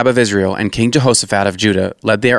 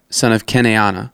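plain reading voice

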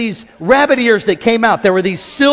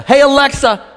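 louder, higher call; calling Alexa; 4 semitones higher, 6 dB louder than usual. Retold or imitated. Preacher calling a smart speaker. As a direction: call across the room to the device. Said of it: "Or Alexa".

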